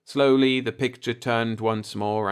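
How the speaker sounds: ordinary delivery, nothing marked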